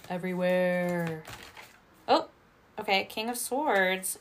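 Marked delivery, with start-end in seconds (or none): none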